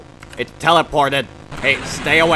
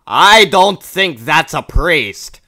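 deadpan; 5 semitones higher, 8 dB louder, slower than usual. Real, not acted. Wry comment on fake priest.

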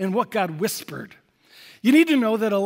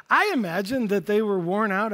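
ordinary talk, nothing marked

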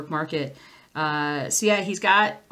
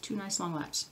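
quick, softer tongue-twister attempt; said fast; 7 dB quieter than usual. Shown as a demonstration. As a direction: rattle it off quickly and quietly. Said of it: "Try to say that fast".

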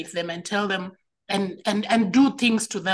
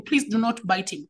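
ordinary delivery

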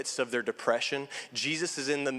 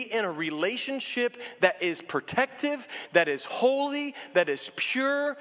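impassioned preaching; 5 semitones higher than usual. Acted. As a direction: preach it up, climbing on each phrase. Preacher builds to fervor.

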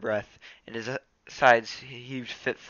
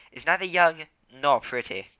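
ordinary delivery

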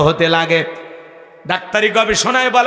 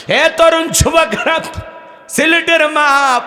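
preaching call; 8 semitones higher than usual. Real, not acted. Preacher addresses the youth.

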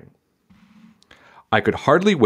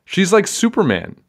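amazed; 10 semitones higher, 3 dB louder than usual. A narrator's reading. Kid voice marvelling.

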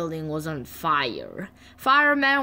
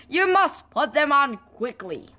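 higher-pitched cross order; 7 semitones higher than usual. A narrator's reading. Man tells children to put masks on, cross. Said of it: "shouted the man crossly".

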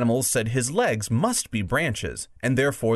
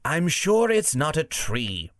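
higher, slow badger character; 5 semitones higher, slower than usual. Badger disputing the tree idea, higher slow badger voice. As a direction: raise the pitch and slow down as a badger. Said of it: "said the badger".

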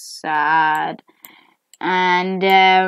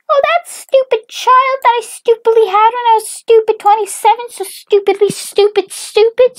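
very high, loud, rapid-fire tirade; shrieky cartoon mom, hammering every insult; hateful mom rant; 6 dB louder than usual. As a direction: rant on and on in a squeaky put-on voice. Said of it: "who still hates her".

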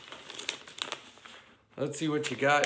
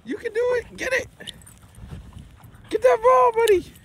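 high, sing-song pet talk; dog-cheering voice; 4 dB louder than usual. Real, not acted. Owner cheering his dog to fetch the ball, high sing-song voice.

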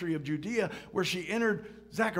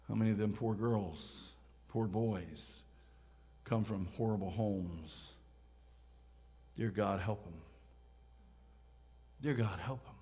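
low, soft, pausing prayerful tone; hushed compassion; 8 semitones lower, 8 dB quieter than usual. Real, not acted. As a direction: ponder it quietly, then plead with God. Pondering poor children, praying for them.

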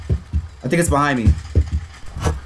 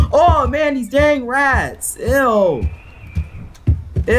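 high, drawn-out disgust; slow groan of disgust; 10 semitones higher, much slower than usual. Real, not acted. Disgusted by rats.

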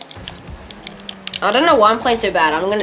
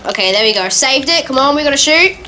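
normal talking voice

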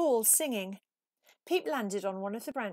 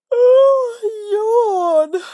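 long, much higher and louder yawning cry; huge yawn; 13 semitones higher, 19 dB louder than usual. A narrator's reading. Exhausted Peep yawning instead of singing at the audition. Peep too tired, yawns.

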